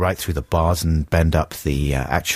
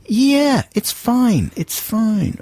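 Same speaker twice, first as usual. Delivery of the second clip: dismissive director; much slower than usual. Retold or imitated. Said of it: "They just say".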